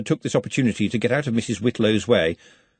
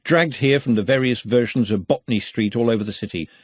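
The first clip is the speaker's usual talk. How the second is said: ordinary delivery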